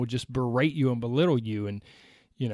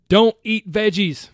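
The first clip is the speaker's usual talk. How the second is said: loud, tongue-in-cheek warning; mock advice; 5 semitones higher, 8 dB louder than usual. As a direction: announce it with a grin. Ironic tip announced loudly.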